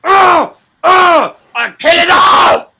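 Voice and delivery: retold mad yell on the train; wild shout. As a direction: bellow like a madman on the train. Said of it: "headbutting windows ... going".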